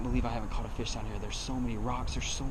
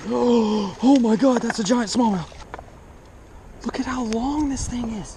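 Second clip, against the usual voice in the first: excited shouting; 12 semitones higher, 9 dB louder than usual. Real, not acted. Huge bass just landed, shouting.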